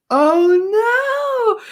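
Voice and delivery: high, loud shocked cry; Oh no at mom's dropped cookies, shocked and loud. Real, not acted. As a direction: react to bad news, loud and high.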